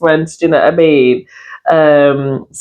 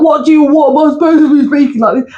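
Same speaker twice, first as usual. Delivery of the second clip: high-pitched mock put-on voice; retold, exaggerated attempt at another way of talking; trying-hard imitation; 10 semitones higher than usual. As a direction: mimic someone straining to fake street talk. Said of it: "he's trying his hardest to try and speak like".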